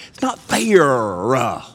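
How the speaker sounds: "fair" stretched slowly, with an "uh" on the end; mock middle-schooler whine on "fair, uh"; added "uh" ending. Shown as a demonstration. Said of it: "you have to get a little "uh" on the end".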